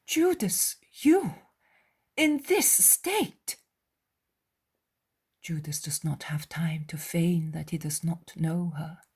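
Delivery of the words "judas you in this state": high, shocked cry of his name. Acted. Mary startled to find Judas in this state. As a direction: recoil at seeing him like this.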